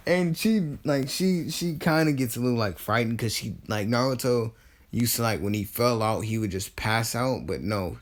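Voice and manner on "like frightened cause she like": usual speaking voice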